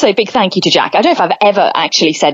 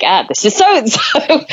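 slow, higher compere announcement; announcer voice; 5 semitones higher, slower than usual. Retold or imitated. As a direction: announce it like on a mic. Announcing on the mic.

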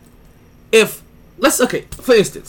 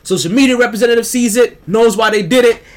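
ordinary delivery

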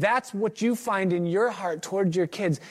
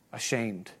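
ashamed; 5 semitones lower, 7 dB quieter than usual. Acted. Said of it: "I have knelt there".

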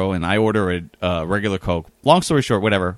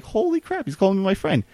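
amazed disbelief; 9 semitones higher, 3 dB quieter than usual. Retold or imitated. Stunned at being called friend.

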